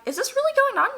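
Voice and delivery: very high squeak of disbelief; shocked disbelief. Retold or imitated. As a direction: ask it in a high, stunned voice. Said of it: "I'm like".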